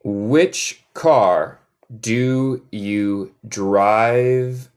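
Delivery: extremely slow, word by word; very slow dictation-like modelling; drawn-out model question. Shown as a demonstration. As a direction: stretch each word for learners to follow. Teacher models question for repetition.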